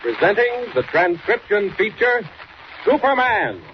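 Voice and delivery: high, ringing show announcement; announcer booming the title; announcer's big intro. Acted. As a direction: sell the title like a radio announcer.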